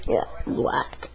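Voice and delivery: a hushed muttered verdict; whispered aside. Real, not acted. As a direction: whisper it softly to yourself.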